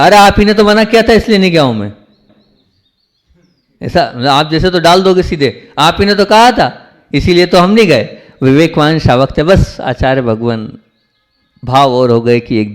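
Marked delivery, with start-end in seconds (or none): 0.0-1.9 high, loud, quick protest; mock protest, putting the blame back on the guru; accusing retort. Imagined devotee blames the guru for his staying back, accusing. 5.8-8.2 high-pitched mock protest; accusing retort. Imagined blunt devotee answers back to the guru, accusing.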